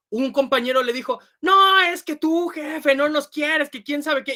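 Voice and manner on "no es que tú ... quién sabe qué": slow, higher, louder retort; arguing coworker. Retold or imitated. Coworker arguing back at the boss, slow and indignant. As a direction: push back at the boss, aggrieved. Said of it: "y empiezan a discutir muchísimo".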